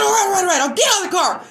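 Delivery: high squeaky imitation of a man backing down; mock panicked stalker. Mocking the stalker as he gives in, high and pleading. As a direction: whine it like he's caving.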